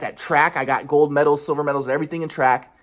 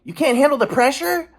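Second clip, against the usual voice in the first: very high berating mom voice; 12 semitones higher than usual. Retold or imitated. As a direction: mimic mom scolding, pitched way up. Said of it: "She was like".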